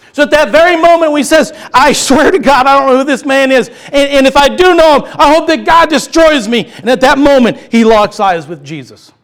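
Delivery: loud, high impassioned preaching; fiery revival preacher; preaching at full force. Acted. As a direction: drive it home at the top of the voice.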